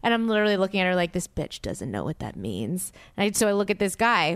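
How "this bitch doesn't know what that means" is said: much lower, soft muttered aside; low under-the-breath judgment; inner thought. Retold or imitated. Staring at her friend, thinking it low and soft.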